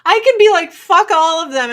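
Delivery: high, loud burst of defiance; loud rant. Real, not acted. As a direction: blast it out, fed up. Ranting about saying anything.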